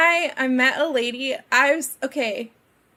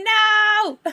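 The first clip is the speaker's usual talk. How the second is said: loud, high mock cry; exasperated outburst; 9 semitones higher, 4 dB louder than usual. Streamer fed up with an embedded link, mock outburst.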